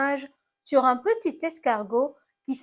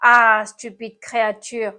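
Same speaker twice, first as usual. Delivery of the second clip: groaning complaint; 7 dB louder than usual. A narrator's reading. Snail scolds the apple that hit it, groaning. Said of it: "gémit l'animal cornu".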